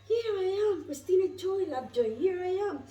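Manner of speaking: high, lifted voice of the angels; bright angelic messengers calling her name; angels speaking. Acted. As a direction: sing out their greeting like heavenly voices. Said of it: "And they said".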